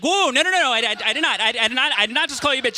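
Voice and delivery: mock panicked denial; defensive protest. Boyfriend protesting to his girlfriend, loud and flustered. Acted quarrel, defending himself.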